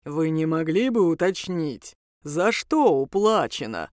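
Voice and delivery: low, slow, deep demon voice; deep, unhurried creature's query; polite bass voice. A narrator's reading. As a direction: inquire courteously in a drawn-out bass. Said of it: "поинтересовался тот же голос".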